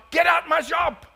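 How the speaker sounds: high, loud mock-angry yell; shopkeeper yelling. Turkish shopkeeper yelling at a customer who won't haggle, mock angry. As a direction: throw the customer out in a mock fury.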